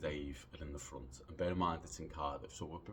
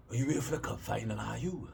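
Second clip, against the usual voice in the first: loud, higher-pitched Welsh dog walker; Welsh accent impression; 5 semitones higher, 6 dB louder than usual. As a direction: put on an eager Welsh accent. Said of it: "basis for my Welsh, uh, accent impression".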